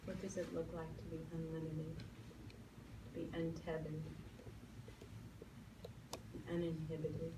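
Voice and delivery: calm meditation voice with long pauses; guided meditation. Acted. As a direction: lead them gently into stillness.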